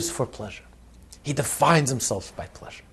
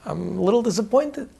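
rich man; 7 semitones higher than usual. Retold or imitated. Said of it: "He says".

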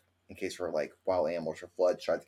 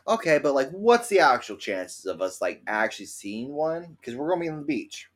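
mock disbelief; 6 semitones higher, 4 dB louder than usual. Retold disbelief about gator chances.

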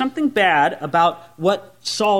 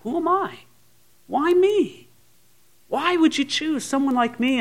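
humble disbelief; 9 semitones higher than usual. Acted. Prophets called by God asking why me, humbly. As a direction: ask in a high, meek voice, pausing between questions. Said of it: "Saul had a humble heart".

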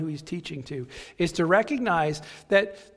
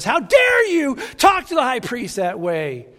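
a guard yelling in outrage, higher and louder; angry shout; 7 semitones higher, 9 dB louder than usual. Retold or imitated. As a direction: bark it at the prisoner like a slap. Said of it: "pops him on the face and says".

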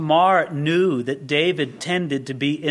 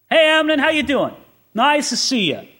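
high, smiling mock-cheery greeting; put-on chummy hello, pitched up; fake friendliness; 8 semitones higher, 3 dB louder than usual. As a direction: greet him brightly to hide your hatred. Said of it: "hatred with a smile".

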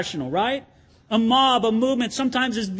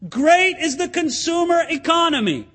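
mock crying out; 6 semitones higher than usual. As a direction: call it out like a fan. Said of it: "cry out".

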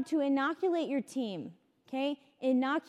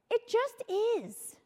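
slow emphatic; 8 semitones higher, much slower than usual. Retold or imitated. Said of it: "And he's like".

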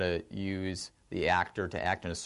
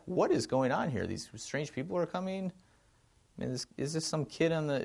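higher-pitched, puzzled questioning; bewildered onlooker; 7 semitones higher than usual. Acted. Imagined onlooker at the Nativity asking himself, bewildered. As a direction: wonder aloud in mild bafflement.

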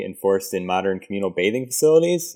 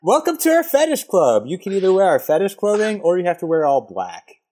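mock club greeter; 7 semitones higher than usual. Fetish club host welcoming patrons and giving dress options, high mock greeting.

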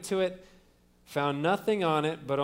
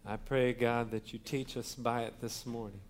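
soft, lowered prayer voice, quiet and reverent; bow your head and pray softly before the word; 5 semitones lower, 7 dB quieter than usual. Real, not acted. Pastor praying over the congregation before the sermon, soft and reverent.

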